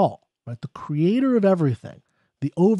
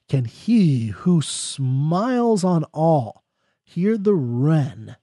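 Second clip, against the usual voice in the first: very slow, drawn-out recital; poem reading; much slower than usual. Acted. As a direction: stretch each word out. Rereads stanza very slowly.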